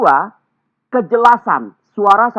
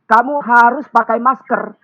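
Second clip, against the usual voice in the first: "harus" leaned on, a higher, slow, bossy order; harsh command; 6 semitones higher, 5 dB louder than usual. Shown as a demonstration. Speaker showing webinar audience a too-harsh mask order, bossy. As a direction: bark the order with weight on "harus". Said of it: "Kata ini terlalu keras".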